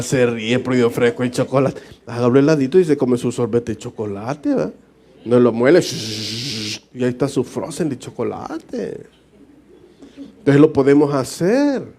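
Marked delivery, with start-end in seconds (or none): none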